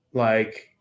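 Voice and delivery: normal talking voice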